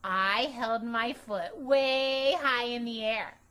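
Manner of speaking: ordinary delivery, nothing marked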